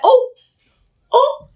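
high-pitched imitated realisation; mock surprise. Supermarket strangers learning it's a wig, mock surprised. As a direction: squeak out a taken-aback oh.

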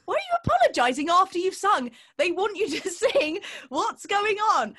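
high, loud, incredulous rant; mock exasperation. Comedian berating herself for apologising after singing, exasperated. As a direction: scold yourself in comic disbelief.

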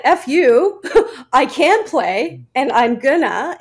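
loud, defiant retort; defiant shout. Retold or imitated. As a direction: fire it back, loud and cocky. Retold defiant reply to boyfriend.

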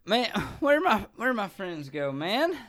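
put-on high-pitched drunk Southern whine; drunk Southerner. Drunk passenger looking for friends, Southern drunk voice. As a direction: drunkenly ask around with a Southern accent. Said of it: "stuck in the Southern accent".

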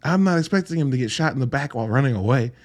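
usual speaking voice

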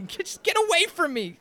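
high exasperated snap; annoyed high whiny man. Acted. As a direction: whine it out, fed up. Thug dismisses hero.

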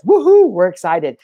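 a big high whoop of joy; excited cheer. Real, not acted. Host whooping at his live viewers, excited. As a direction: cheer it out loud for the chat.

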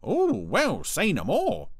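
high, loud, knowing retort; exaggerated high-pitched deference; pointedly insinuating Brian. A narrator's reading. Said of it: "in a tone of voice that suggested".

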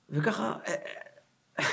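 normal talking voice

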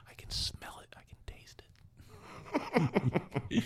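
giggling breathy whisper; giggly prank whisper. Pretend. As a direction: whisper it with a laugh. Whisper bit continues, laughing.

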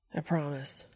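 a tender hushed vow into her hair; soft whispered promise. Acted. Dean holds crying friend close.

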